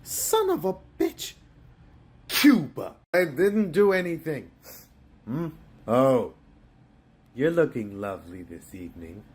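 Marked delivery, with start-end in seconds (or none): 0.0-2.9 very high, furious exclamation; outraged outburst. Acted. The boss reads the report and bursts out high in fury. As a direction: explode with rage high up.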